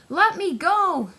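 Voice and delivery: high, loud, slow plea; crying out. Acted. Diesel crying out as Cranky lifts him. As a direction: beg to be let go. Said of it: "cried Diesel".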